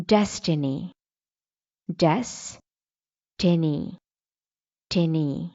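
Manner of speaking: डेस्टिनी spelled out slowly, the T lightly aspirated in टिनी; careful demo of destiny. Shown as a demonstration. Teacher drilling learners on डेस्टिनी, slow and careful. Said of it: "Let's see".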